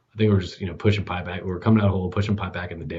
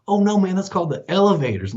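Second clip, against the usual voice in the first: high, emphatic correction; earnest protest; 11 semitones higher, 4 dB louder than usual. Retold or imitated. Nice buddy corrects a wrong word, high earnest protest. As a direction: set him straight, high and insistent.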